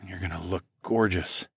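softly murmured. A narrator's reading. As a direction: murmur it tenderly. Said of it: "I murmured".

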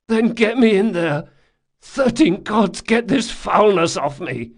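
high, loud disgusted urgency; desperate loud demand. Acted. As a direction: shout to be washed clean. Locke desperate to wash off.

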